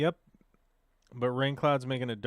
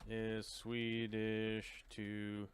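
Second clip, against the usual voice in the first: very soft, slow, stretched words; 13 dB quieter, much slower than usual. Real, not acted. Streamer typing a question about Danish, soft and drawn out. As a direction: murmur it piece by piece as you type. Speaking a search while typing.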